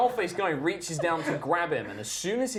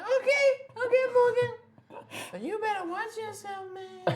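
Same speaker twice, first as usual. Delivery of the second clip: very high squeak, backing off with a warning; little frog gangster. Acted. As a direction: squeal a retreat, then a threat. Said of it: "He, like, backs off. He's like".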